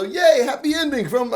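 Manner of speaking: high, bright yay over the happy end; excited cheer. Real, not acted. Reviewer cheering the manga's happy ending, excited high cheer. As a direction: cheer it like a fan.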